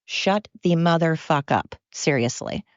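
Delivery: ordinary talk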